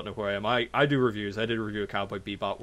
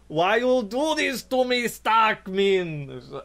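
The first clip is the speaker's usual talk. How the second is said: put-on slow, higher, accented cry of despair; Wiseau impression; 9 semitones higher, much slower than usual. Host quoting Tommy's famous line in a slow accented voice. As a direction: whine it like a wounded Tommy.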